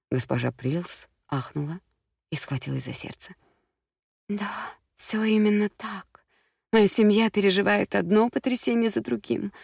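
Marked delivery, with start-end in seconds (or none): 4.3-9.5 высокий взволнованный голос дамы; тараторит, не давая вставить слово; тараторит взволнованно. A narrator's reading. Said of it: "затараторила она". (высокий голос, взахлёб)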